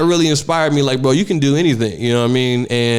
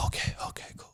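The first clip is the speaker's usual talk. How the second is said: whisper; 19 dB quieter, faster than usual. Retold or imitated. Nervous kid before going live.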